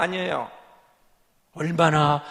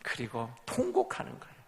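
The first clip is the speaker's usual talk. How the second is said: usual speaking voice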